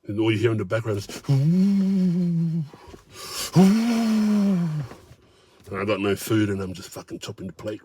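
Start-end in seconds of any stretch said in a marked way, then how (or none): 1.3-4.6 higher drawn-out ooh; higher teasing ooh from the table; mocking crowd ooh. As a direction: tease like onlookers at a fight. Onlookers reacting in background.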